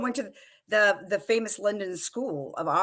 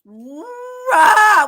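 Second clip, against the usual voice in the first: roar; 14 semitones higher, 11 dB louder than usual. Pretend. Imitating the shark roar.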